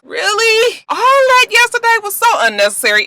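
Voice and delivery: high, incredulous complaint; fed up, pitch it way up; exasperated. Real, not acted. Exasperated by episode count.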